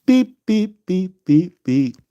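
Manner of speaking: high beeps falling step by step; acting dumb with high falling beeps; silly beeping. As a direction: beep like a goofy toy winding down. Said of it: "I'm acting dumb".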